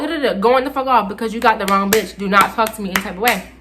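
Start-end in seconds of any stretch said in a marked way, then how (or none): none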